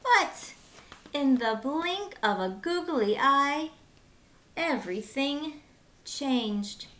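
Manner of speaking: put-on bright, lively read-aloud for kids; lively children's storyteller; storybook narration. Reading picture book to children.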